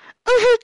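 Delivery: loud, high burst of laughter; hysterical laugh. Acted. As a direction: crack into a manic laugh.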